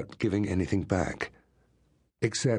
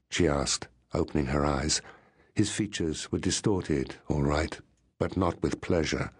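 plain reading voice

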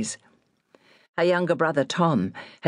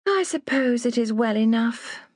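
bored sigh; 7 semitones higher than usual. A narrator's reading. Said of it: "The woman sighed".

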